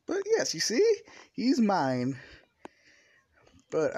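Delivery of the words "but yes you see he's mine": high, loud possessive declaration; possessive boast. Acted. Toga shows her friends he's hers, triumphantly possessive. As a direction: crow it proudly, pitched right up.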